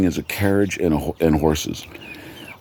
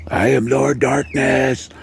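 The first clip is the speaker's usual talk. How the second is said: high, slow vampire proclamation; Lord Darkness; 7 semitones higher, slower than usual. Acted. Gleeful vampire to cussing crowd, high slow boast. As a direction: announce it grandly, drawn out and high.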